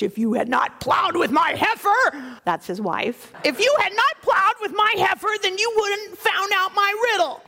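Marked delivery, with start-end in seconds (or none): 0.0-2.4 outraged shout. Acted. Samson yells at the men who tricked his wife, outraged. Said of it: "And Samson was so outraged". 3.4-7.4 a raised, higher furious shout; outraged shout. Acted. Samson yells at the men who tricked his wife, outraged. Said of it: "And Samson was so outraged".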